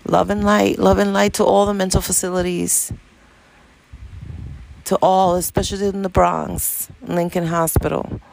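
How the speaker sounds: lifted, heartfelt blessing sent outward; blessing prayer. Acted. Sends blessing to mental facilities.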